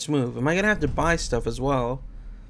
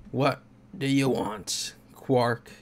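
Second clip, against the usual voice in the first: gruff guard; slower than usual. Acted. Guard gruffly greeting the lawyer.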